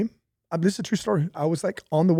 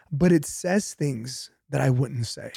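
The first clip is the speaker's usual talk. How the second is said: ordinary talk, nothing marked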